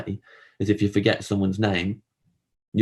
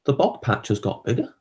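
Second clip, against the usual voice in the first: mock wistful thought; 6 semitones higher than usual. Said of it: "I'm like".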